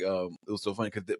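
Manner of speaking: usual speaking voice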